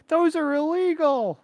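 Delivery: high squeaky mock voice. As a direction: squeak it out like a scandalized kid. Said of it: "And it's like".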